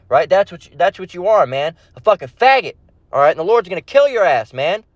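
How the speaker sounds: ordinary talk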